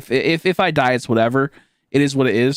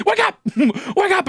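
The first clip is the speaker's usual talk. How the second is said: urgent wake up; slower than usual. Retold or imitated. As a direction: shout to keep him conscious. Mom shaking him awake.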